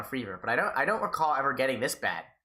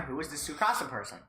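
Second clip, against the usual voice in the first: ordinary talk, nothing marked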